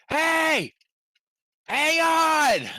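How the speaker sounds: loud high call across the water; hollering across the sea. Acted. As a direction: shout to them far off. Calling out to distant figures.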